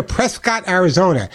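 newcomer's Prescott, ending in a full "cot"; mispronounced Prescott. Retold or imitated. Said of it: "It's not Prescott".

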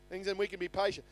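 ordinary talk

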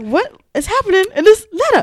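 high, loud bewildered question; baffled disbelief. Retold or imitated. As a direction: sound completely thrown by it. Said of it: "I was like".

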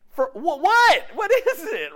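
high, loud incredulous questioning; exasperated disbelief. Acted. Preacher challenging a person freaking out, exasperated disbelief. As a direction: demand the reason, half laughing.

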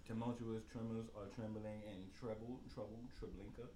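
low, quiet, with questioning rises; questioning intonation. Pretend. Contestant trying the tongue twister, said like a question. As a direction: recite it softly, TROUBLE lifted like a question. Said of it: "I said it like a question".

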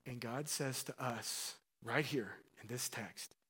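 ordinary talk

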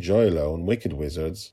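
ordinary delivery, nothing marked

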